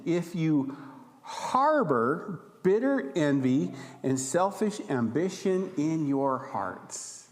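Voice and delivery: ordinary talk, nothing marked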